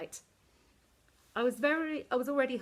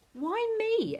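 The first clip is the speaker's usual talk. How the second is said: high, loud protest; cried out; 6 dB louder than usual. A narrator's reading. Esther protests at Queenie, high loud outrage. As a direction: wail it, outraged. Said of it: "Esther cried".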